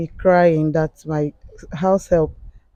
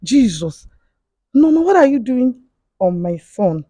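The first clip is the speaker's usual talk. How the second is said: raised, higher-pitched alarmed outcry; retold shout; 6 semitones higher, 4 dB louder than usual. Mother catches house help on her son, retold shout. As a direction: imitate shouting in shock, without full force. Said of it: "I shouted".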